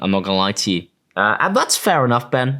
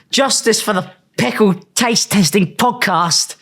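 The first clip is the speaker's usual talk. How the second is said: high, slow, hammed-up protest chant; rally the crowd for a silly cause; mock rallying cry; 10 semitones higher than usual.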